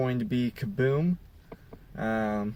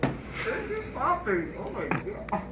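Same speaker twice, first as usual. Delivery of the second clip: eating sour candy; 7 semitones higher, 4 dB quieter, much slower than usual. Real, not acted.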